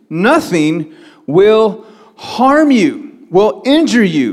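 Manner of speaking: slow, high-pitched preaching emphasis; emphatic promise. Acted. Preacher voices Jesus' promise.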